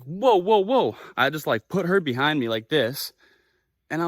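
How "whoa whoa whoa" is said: reenacted alarm. Stopping an aggressive man approaching.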